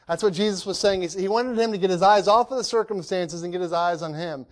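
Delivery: usual speaking voice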